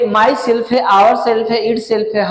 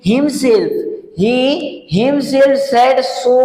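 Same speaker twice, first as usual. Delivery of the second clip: himself said so drawn out very slowly; teacher reading out a sentence very slowly; slow example read; much slower than usual. Shown as a demonstration. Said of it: "अगला example कह रहा है कि".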